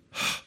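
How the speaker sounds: "huh" as a soft breath with no voice; breathy h sound. Shown as a demonstration. Teacher showing students the rough breathing sound, soft breathy huh. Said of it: "When do they say".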